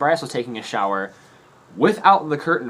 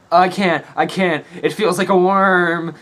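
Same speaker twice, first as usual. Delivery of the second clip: higher, louder squeamish whine; whiny disgust; 6 semitones higher, 6 dB louder, slower than usual. Retold or imitated. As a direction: imitate a friend grossed out by his own wound. Ben peeling skin off knee.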